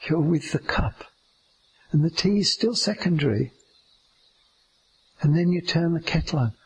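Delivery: ordinary talk, nothing marked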